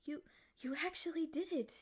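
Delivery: breathless, high whisper of disbelief; stunned whisper. Acted. Freed demon whispering in disbelief to its rescuer. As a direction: whisper it in shock.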